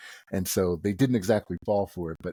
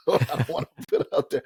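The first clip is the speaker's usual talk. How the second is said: words spilling through a real laugh, pitched up; 7 semitones higher than usual. Real, not acted. Podcast host laughing to co-hosts about his unprofessional email name. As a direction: laugh while you say it, a bit embarrassed.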